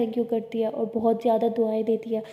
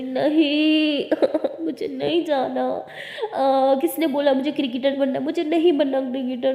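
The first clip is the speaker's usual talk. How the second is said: put-on childish whine with a cry and laugh in it; whiny refusal; 3 semitones higher, 4 dB louder than usual. Lily whining to her girlfriend about cricket practice, a childish whiny refusal. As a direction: whine like a spoiled kid who won't go.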